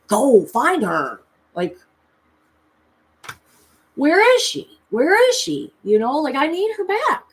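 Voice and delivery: frantic, high-pitched demand; desperate plea. Retold or imitated. Pleading with her guide to find the blue fairy, desperate. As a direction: beg loudly, voice leaping up high.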